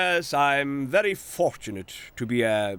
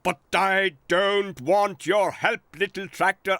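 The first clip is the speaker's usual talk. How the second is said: fuming; 6 semitones higher than usual. Acted. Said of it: "fumed the J C B".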